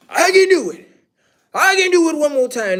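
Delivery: high, pleading mock boast; mock Ric Flair. Mocking Ric Flair's comeback claim in a high pleading voice. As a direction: imitate an old wrestler insisting he's still got it. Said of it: "Ric Flair said that".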